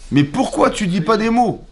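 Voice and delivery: high, slow, insistent; stretched-out, baffled complaint; exasperated question. Acted.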